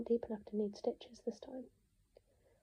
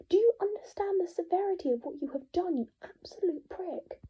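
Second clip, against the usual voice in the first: much higher, loud, slowing at the end; furious tirade; 8 semitones higher, 7 dB louder than usual. Acted. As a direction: tear into him down the phone. Furious phone call to Nick.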